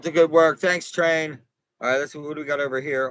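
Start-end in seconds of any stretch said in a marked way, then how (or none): none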